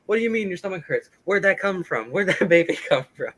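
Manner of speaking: imitating a bewildered husband; startled husband. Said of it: "And then he's like".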